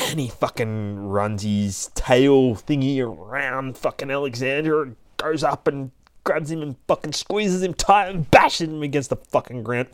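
ordinary delivery, nothing marked

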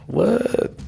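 startled high rising what; surprised what. Acted. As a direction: pop up in mock disbelief. Reacting to boss's odd answer.

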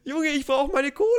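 high-pitched cranky miser; grumpy miser. Pretend. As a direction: whine about the money, high. Acting out a stingy Swabian.

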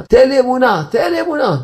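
high, insistent cry to God; urgent prayer plea. Acted. Worshipper begging God for faith, urgent high plea. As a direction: plead upward, stretching the words.

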